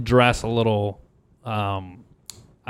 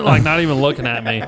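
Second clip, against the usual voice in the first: usual speaking voice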